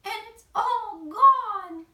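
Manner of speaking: mock crying. A narrator's reading. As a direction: wail that the porridge is gone. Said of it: "Why did you cry right now?".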